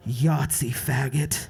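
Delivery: low, menacing taunt; a low sneering whisper-taunt; bully's low taunt. Retold or imitated. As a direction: drop low and sneer it close to the ear. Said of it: "he's like".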